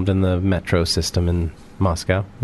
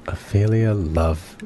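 slow savouring; much slower than usual. Real, not acted. Guest tries savouring the same name.

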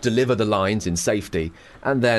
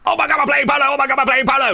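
high, loud, giddy shout as the pony; excited polo pony; 6 dB louder, faster than usual. Retold or imitated. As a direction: squeal it like an overexcited horse. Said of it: "they are like".

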